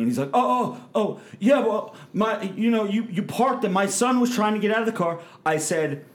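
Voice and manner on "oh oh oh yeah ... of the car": high, fast, flustered stammer; mock the startled man's nervous excuses; stuttering nervous guy. Startled man stammering excuses to the angry driver, flustered and high. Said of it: "he starts stuttering".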